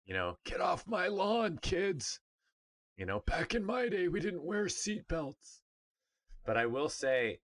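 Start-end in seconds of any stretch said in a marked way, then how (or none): 0.4-2.2 put-on high, drawn-out old-timer yell; mock grumpy old man in a high, slowed-down voice; cranky old man. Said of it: "Yes, I'm getting old. Yes, I sound like". 3.2-5.6 put-on high-pitched, reminiscing old-timer; cranky old man. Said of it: "Yes, I'm getting old. Yes, I sound like".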